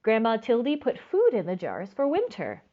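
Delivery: usual speaking voice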